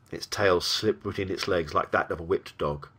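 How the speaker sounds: plain reading voice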